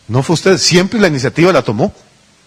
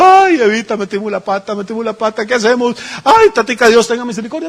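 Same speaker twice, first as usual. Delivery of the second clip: mock wailing lament; 6 semitones higher than usual. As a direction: pretend to be Adam wailing to Eve and God. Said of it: "no estaban llorando diciendo". (lamento llorón exagerado y agudo, en broma)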